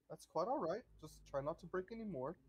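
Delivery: a courteous butler asking a favour; ghost butler. Acted.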